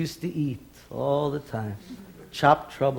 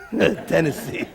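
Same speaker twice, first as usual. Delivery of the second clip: laughing repeat; 3 dB quieter, slower than usual. Real, not acted.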